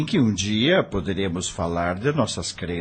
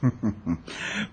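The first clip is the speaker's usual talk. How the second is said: soft fond chuckle; 7 dB quieter than usual. Acted. Father amused by daughter's request.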